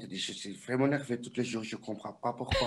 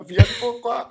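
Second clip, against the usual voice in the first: excited loud retold question; 10 semitones higher, 11 dB louder than usual. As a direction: throw the question up loud and laughing. Retells asking [name], laughing. (question lancée fort et aiguë, en riant)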